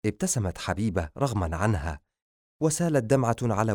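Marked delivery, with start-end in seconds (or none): none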